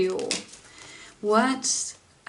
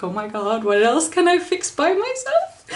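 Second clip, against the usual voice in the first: retold elated amazement; so happy; 7 semitones higher, 5 dB louder than usual. Reliving the fixed bicycle, so happy. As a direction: voice climbing high and loud with delight. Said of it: "I was so happy after".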